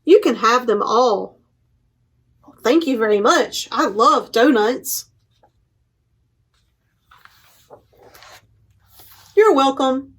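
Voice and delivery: lively picture book read-aloud with paused page turns; reading to children. Acted. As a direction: read it out to a room of little kids, giving each animal a light voice.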